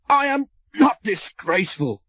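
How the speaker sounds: high, loud, furious protest. A narrator's reading. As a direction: spit it out in fury. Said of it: "choked Thomas furiously".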